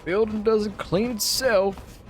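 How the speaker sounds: high, slow mock echo; mimicking the janitor. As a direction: parrot the janitor's line. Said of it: "S1 said it just before".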